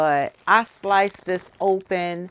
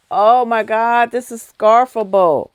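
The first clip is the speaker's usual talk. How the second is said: loud, raised, emphatic rave; excited gushing; 3 semitones higher, 11 dB louder than usual. Real, not acted. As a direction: exclaim it in loud delight. Gushing over the soft yarn.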